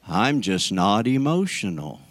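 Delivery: low, subdued mimicry of denial; mock reserved. Preacher imitating those who say they don't react, mock reserved. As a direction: drop low and play the unemotional churchgoer.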